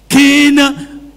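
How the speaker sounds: ordinary talk, nothing marked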